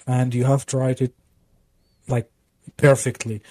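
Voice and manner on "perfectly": usual speaking voice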